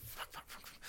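panicked whispered curses under the breath; whispering curses at the security desk; whispered swearing. Retold or imitated. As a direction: hiss the curses so no one hears.

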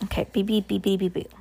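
usual speaking voice

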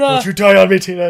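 high, loud desperate cry; hammed-up plea. Vampire holding dying Tina, loud mock plea. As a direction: overplay the dramatic plea.

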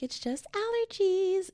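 very high, drawn-out singsong; mock dismissive. Podcaster mocking own earlier self-diagnosis, airy high-pitched denial. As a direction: stretch it out high, poking fun at yourself.

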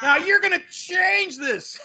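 high, slow, hammed-up shouted demand; mock outraged actor. Actor ordering writers to change the script, mock outrage. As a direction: stretch the order into a comic tantrum. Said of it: "storms in with a script".

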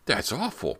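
appalled, pitched well up; high-pitched burst of dismay; shocked exclamation. A narrator's reading. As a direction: exclaim it in mild horror. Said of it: "Brant exclaimed".

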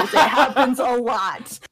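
giggling through the words; laughing. Real, not acted. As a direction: laugh it out, slow. Laughing confession.

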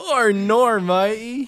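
high, loud hammed-up Aussie; bad Australian accent. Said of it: "my Australian accent's really bad".

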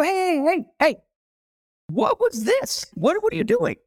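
high, confused calling after the waiter; baffled calling out. Retold or imitated. Calling the waiter back, baffled and high. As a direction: call him back, bewildered.

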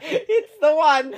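slow, high words through laughter; laughing. Real, not acted. Co-host reacting to her story, slow and laughing. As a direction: drag it out while cracking up.

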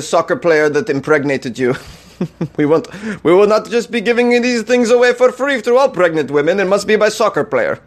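put-on a light, jokey Russian accent reading the ad; broken English with a hint of Russian; lightly put-on Russian accent; mock Russian accent. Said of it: "Is that a pretty good Russian accent".

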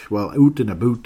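hammed-up Canadian vowels on "out and about"; Canadian accent. Shown as a demonstration. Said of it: "Can you sense the Canadian accent".

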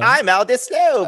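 high, slow mock-British voice; Aldous Snow. Co-host joins the Sarah Marshall bit, high British impression. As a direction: drawl it in a hammed-up British accent. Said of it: "that's my forgetting Sarah Marshall".

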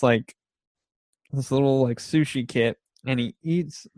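usual speaking voice

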